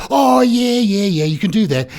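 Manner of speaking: high-pitched burst of yeses; mock eager agreement. Genius grants clown's odd shower request, mock eager agreement. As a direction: leap up high, then settle while agreeing.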